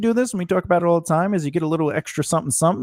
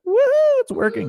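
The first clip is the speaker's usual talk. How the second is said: excited. Real, not acted. Cheering the stream working.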